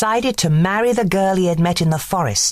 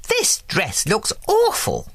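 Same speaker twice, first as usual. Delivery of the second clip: higher, slow fussy fairy verdict; a slow, raised fairy voice passing judgement; Merryweather declaring; 6 semitones higher than usual. Acted. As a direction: pronounce the dress a disaster. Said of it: "declared Merryweather".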